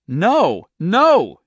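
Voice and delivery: two high, loud refusals; emphatic, distressed denial. A narrator's reading. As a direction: insist loudly, pitch jumping up. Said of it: "she said loudly".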